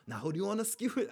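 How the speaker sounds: half-hearted accent imitation; Ned Stark. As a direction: have a go at his accent, badly. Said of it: "I can't do the accent".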